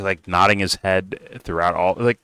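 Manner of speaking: ordinary talk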